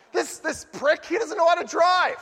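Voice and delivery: high, loud mock anger; angry driver. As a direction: sputter with rage. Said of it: "And I'm like".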